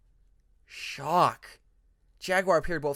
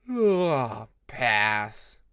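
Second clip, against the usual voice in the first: yawned; 5 semitones lower, 6 dB louder than usual. Acted. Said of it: "yawned Jaguar".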